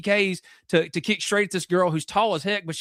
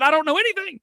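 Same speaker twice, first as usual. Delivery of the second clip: exasperated protest; 10 semitones higher, 4 dB louder, faster than usual. Retold or imitated. Protesting to angry buddy.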